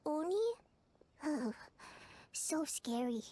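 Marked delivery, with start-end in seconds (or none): 1.2-3.2 whispered, mock frightened; mock scared whisper. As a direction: breathe it like a scared secret. Mock fear at Hornless Oni.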